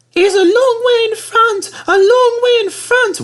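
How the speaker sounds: very high, loud, slow wail; wailing coaches. Acted. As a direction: wail in despair. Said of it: "wailed Annie and Clarabel".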